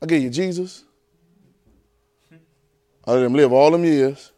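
ordinary talk, nothing marked

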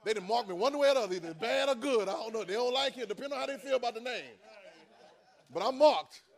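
loud, high preaching; preaching at full force. Acted. Preacher crying out to the congregation about being marked. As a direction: shout it like a Sunday peak.

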